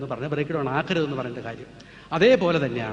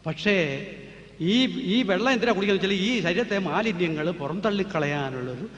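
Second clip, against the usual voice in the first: ordinary delivery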